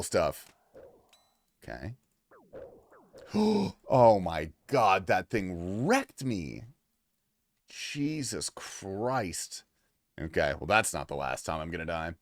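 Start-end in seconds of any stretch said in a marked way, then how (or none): 3.3-3.9 sharp gasp of shock; startled gasp. Real, not acted. Startled when killed in game. 3.9-9.6 stunned dismay. Real, not acted. Just got killed in game.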